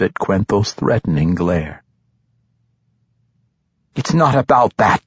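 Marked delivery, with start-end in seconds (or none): 3.9-5.0 confident. A narrator's reading. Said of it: "the matron mother said with confidence".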